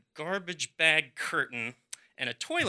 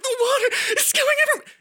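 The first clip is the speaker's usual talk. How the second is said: much higher and louder, frantic; shrill panic; 8 dB louder, faster than usual. Retold or imitated. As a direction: squeal it out in full alarm. Panic rising at the flood.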